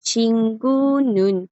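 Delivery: Chingu nun, slowly spelled out; pronunciación lenta. Shown as a demonstration. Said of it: "porque la U está viendo hacia abajito". (pronunciación coreana lenta de Chingu nun)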